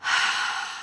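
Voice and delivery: long audible breath out through the mouth; big sigh. Shown as a demonstration. Teacher shows the children a calming big sigh. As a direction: let it all go in one sigh. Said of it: "like a big sigh".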